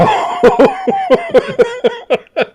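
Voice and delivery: long high wheezing laugh; drawn-out high cackle; cracking up. Real, not acted. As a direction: burst into loud, helpless laughter. Cracking up at own joke.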